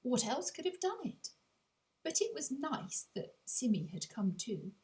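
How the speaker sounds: ordinary delivery, nothing marked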